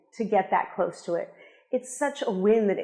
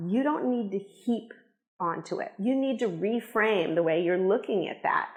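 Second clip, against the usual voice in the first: ordinary delivery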